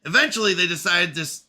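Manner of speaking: lightly voiced bitter machine intelligence; AI character voice.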